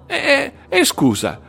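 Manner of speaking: very high, loud, laughing through words; tipsy laughing suggestion. Acted. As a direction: pitch a prank, cracking up drunkenly. Drunk friend pitches prank, laughing.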